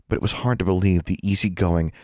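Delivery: usual speaking voice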